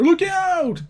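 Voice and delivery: sudden high yell of alarm; shouted warning. Acted. Hero warning Diana of the three-headed creature, shouting. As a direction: cry out to warn a friend of the beast. Sudden beast attack in comic.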